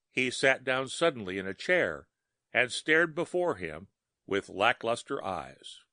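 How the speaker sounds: ordinary delivery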